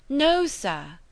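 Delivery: high, loud refusal; indignant cry. A narrator's reading. Young woman rejects suitor's presumption, indignant cry. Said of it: "cried Emma".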